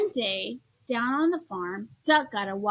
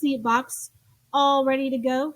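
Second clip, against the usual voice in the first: ordinary delivery, nothing marked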